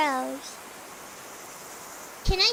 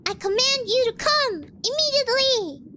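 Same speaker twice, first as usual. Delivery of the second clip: higher-pitched, imperious order; mock command; 7 semitones higher, 3 dB louder than usual. Player ordering fish that will not bite, mock imperious command. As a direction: order them about in play-acted indignation.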